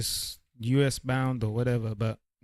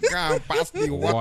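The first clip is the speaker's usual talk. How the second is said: laughing imitation; 6 semitones higher, 4 dB louder than usual.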